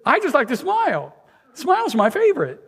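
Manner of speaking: preacher voicing grinning Buddy the Elf, high and bright. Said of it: "And he says".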